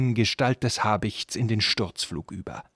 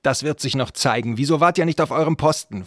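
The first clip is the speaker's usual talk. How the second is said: loud scolding that rises in pitch; sharp rebuke; 5 semitones higher, 6 dB louder than usual. A narrator's reading. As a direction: tear into the guards for negligence. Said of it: "fuhr Shendur die Wachen an". (die Wachen zornig anfahren)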